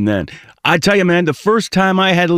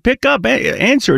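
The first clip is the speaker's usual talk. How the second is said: urgent wife; 7 semitones higher than usual. Retold or imitated. Wife urging him to answer.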